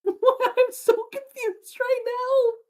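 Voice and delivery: very high, slow laughing squeal; helpless laughter. Real, not acted. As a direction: squeal it through real laughter, almost crying. Helpless laughter at confusing demands.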